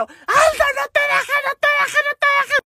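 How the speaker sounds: very high, frantic squeal; hammed-up high-pitched yelling; shrieking. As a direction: imitate excited girls screaming encouragement. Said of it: "empezaron a gritar".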